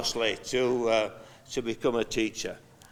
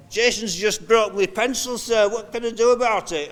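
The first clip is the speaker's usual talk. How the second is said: put-on high, pleading child voice; high, louder little-kid voice; whiny pupil; 8 semitones higher, 4 dB louder than usual. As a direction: imitate a fussy schoolchild asking sir.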